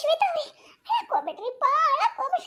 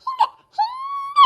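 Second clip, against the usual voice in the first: mock sobbing demo; 5 dB louder, much slower than usual. Shown as a demonstration. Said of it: "ಇದ ನೋಡ್ರಿ ಅಕ್ಕ".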